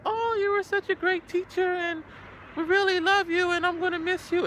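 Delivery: put-on high sweet girlish voice; lightly voiced Japanese schoolgirl; imitating student girl. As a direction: mimic a teary student, high. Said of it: "she's like".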